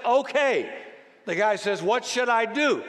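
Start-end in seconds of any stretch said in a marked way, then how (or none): 0.1-0.7 high, loud called-down reply; voice of God. Acted. God answers the man's plea, calling down. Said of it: "The voice says". 1.8-2.8 calling up. Acted. Man calls up to God.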